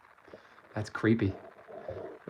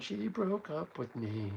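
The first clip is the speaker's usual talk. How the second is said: mopey nineties singer; 5 semitones higher, 11 dB quieter, slower than usual. Retold or imitated. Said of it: "Some guy singing about his girlfriend".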